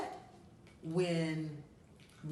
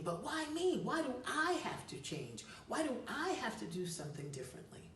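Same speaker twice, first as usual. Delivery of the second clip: pitched up high, a mock whine of why me; mock whiny protest; 7 semitones higher, faster than usual. Advisor voicing the listener's objection, mock whiny protest. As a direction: play the reluctant listener's indignant why-me complaint. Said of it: "I know you might be thinking".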